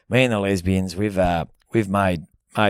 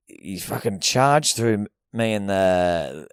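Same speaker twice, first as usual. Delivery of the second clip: usual speaking voice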